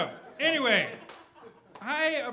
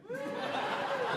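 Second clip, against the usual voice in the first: high squealing laugh; 3 dB quieter than usual. Real, not acted. Laughs at own Doubtfire idea.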